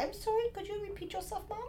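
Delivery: soft, high mock Indian call-center accent; polite Indian-accented agent, lightly mocked; horrible foreign accent. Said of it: "those horrible accents".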